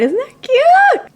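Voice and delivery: very high, loud, stretched squeal; delighted high-pitched gush. Real, not acted. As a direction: squeal over something adorable. Gushing over pet photo.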